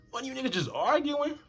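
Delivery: high, exaggerated retold disbelief; high-pitched mock bafflement; incredulous question. Acted disbelieving question to friend.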